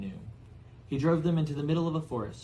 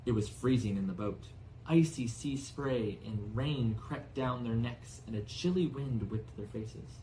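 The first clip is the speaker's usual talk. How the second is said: usual speaking voice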